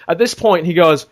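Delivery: normal talking voice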